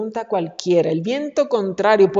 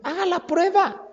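raised, inviting challenge; a hint of a divine dare, pitched up; voicing the Lord's challenge; 8 semitones higher, 4 dB quieter than usual. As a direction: dare them gently to try it. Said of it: "y después dijo".

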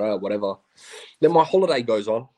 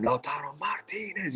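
drawn-out, higher-pitched yell held back in volume; mock yell; 5 semitones higher, 9 dB quieter than usual. Telling host of audio yelling out the player's name, mock yell. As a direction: imitate a fan yelling a name, stretched out but soft. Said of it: "yelling out his name".

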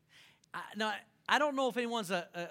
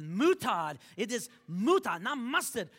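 mock French correcting; 2 semitones higher, 6 dB louder than usual. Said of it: "He said".

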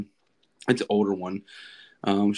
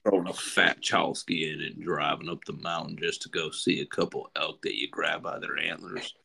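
Matthew McConaughey impression; 3 semitones lower, 3 dB quieter than usual. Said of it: "I can't even talk like that".

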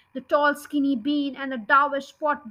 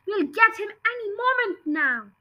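eager; 7 semitones higher than usual. Acted. Bogus shouting to Bounce and Bean.

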